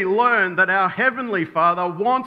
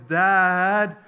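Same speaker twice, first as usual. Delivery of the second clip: put-on little boy calling; 3 semitones higher, 4 dB louder than usual. Little boy calls out once more, drawn-out child's voice. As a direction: call for Dad like a persistent kid. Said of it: "this little voice".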